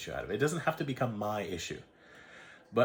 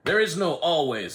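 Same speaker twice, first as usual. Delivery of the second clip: mock stern attendant; 6 semitones higher, 6 dB louder than usual. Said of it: "He says".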